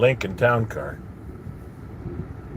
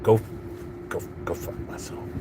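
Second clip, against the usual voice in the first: whispered, much softer, stunned echo; disbelieving whisper; 8 dB quieter than usual. Retold or imitated. Echoing the guy's insult back to himself, stunned whisper. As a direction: breathe it out in shock.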